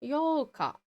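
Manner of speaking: YOLKA with a long O; lengthened O. Shown as a demonstration. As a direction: hold the O in "Yolka". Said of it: "the U is a lengthening vowel for the O".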